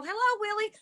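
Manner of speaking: retold high-pitched greeting; calling out. Calling out to the ghost.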